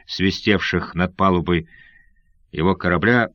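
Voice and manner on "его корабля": ordinary delivery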